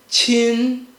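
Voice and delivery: CHIN held long on one flat, level pitch; first tone. Shown as a demonstration. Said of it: "make sure it's flat".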